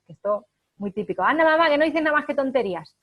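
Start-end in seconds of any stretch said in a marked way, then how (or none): 1.2-3.0 put-on cheeky teen retort, higher, louder and quick; mocking teenager. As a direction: fire it back like a snotty adolescent. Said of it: "qué dice el adolescente a su madre".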